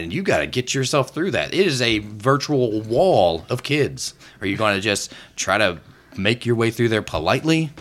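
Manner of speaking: normal talking voice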